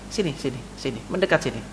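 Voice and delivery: low, soft coaxing "sini, sini"; hushed, inviting call; soft beckoning. Acted. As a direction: gently wave him closer with the voice. Prophet beckoning the youth close.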